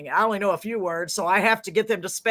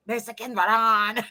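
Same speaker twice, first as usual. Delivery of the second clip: attempted Mexican accent. Shown as a demonstration. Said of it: "say it in a Mexican accent".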